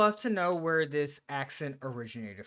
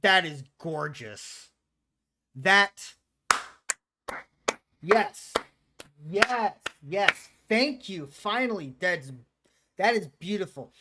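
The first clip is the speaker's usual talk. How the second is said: loud, emphatic, relieved praise; delighted approval; 3 semitones higher, 7 dB louder, slower than usual. Real, not acted. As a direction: punch out each word in higher, delighted bursts. Delighted at the pizza shown.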